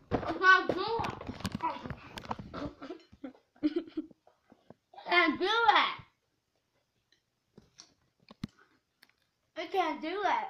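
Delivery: choking on dry crackers; mouth full of crackers. Real, not acted.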